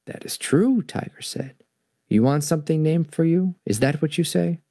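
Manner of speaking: expressive storybook narration with voiced animals; story reading. A narrator's reading. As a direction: read to children, giving each animal a voice.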